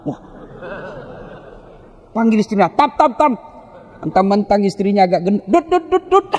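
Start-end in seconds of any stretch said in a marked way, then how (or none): 2.7-3.3 mocking call. Mocking husband calling wife. 5.7-6.3 mocking taunt. Mocking fat wife nickname.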